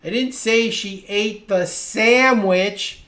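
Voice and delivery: slow line landing loudly on "sandwich"; stress on SANDWICH. Shown as a demonstration. Teacher showing it was other food, stress on "sandwich". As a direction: stretch and punch "sandwich" at the end. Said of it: "I changed the pitch of my voice".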